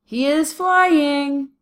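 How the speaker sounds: high, loud, very stretched "flying"; drawn-out progressive. Shown as a demonstration. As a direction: stretch "is flying" out as ongoing motion. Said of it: "Can you see the progress?".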